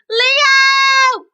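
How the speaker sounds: loudest, highest call of all; loud shout. Acted. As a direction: belt the name out as loud as you can. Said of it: "we'll have to shout him even louder".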